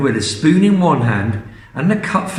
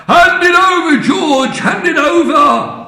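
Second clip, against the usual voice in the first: very high, loud bossy shout; snatch it with your voice; shouting demand; 14 semitones higher, 5 dB louder than usual. Acted. Grandma demanding the cup from George, loud high shout.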